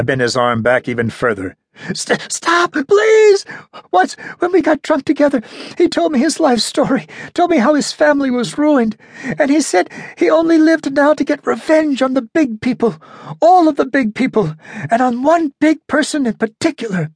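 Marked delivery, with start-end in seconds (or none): 1.9-3.5 high, loud desperate plea; pained begging. A narrator's reading. Pleading in pain. 3.9-17.1 high, tense rushed confession; captured ventriloquist confessing to the detective, high and tense; frightened ventriloquist. A narrator's reading. Voices the ventriloquist character.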